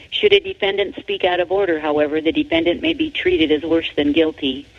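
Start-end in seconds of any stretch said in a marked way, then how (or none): none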